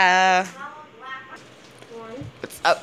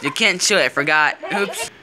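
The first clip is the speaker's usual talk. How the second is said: ordinary talk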